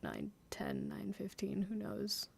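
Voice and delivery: whispered musing to herself. Real, not acted. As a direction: breathe the times out under the voice. Musing quietly to self.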